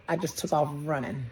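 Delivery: ordinary talk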